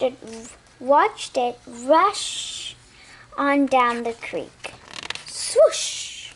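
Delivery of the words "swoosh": airy rushing swoosh, breathy and high; whispered swoosh. A narrator's reading. Reading to a child, an airy water swoosh. Sound effect in story.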